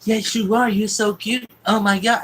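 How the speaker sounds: high, loud cooing over someone cute; gushing baby talk. Retold or imitated. Woman fussing over the boy like a dog, gushing baby talk. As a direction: imitate doting on a puppy.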